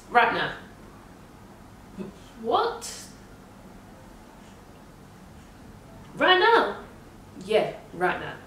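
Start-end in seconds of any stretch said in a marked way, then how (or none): 0.1-0.5 retold firm order. 2.4-6.8 high, loud incredulous question; acted-out shock, pitch leaping; shocked disbelief. Her shocked reply to doctor, retold.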